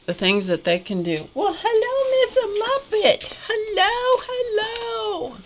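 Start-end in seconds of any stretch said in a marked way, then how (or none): 1.3-5.4 squeaky high voice for a pet. Real, not acted. Dog arrives, greeted.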